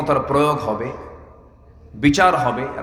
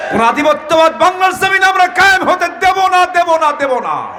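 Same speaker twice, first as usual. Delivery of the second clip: defiant vow; 13 semitones higher, 7 dB louder than usual. Real, not acted. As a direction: hurl the refusal at full voice. Preacher vows no surrender.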